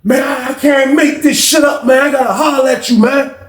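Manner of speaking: high shouted call through the door; excited high-pitched holler; retold scream. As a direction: yell it up high across the pod. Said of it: "He, he screamed".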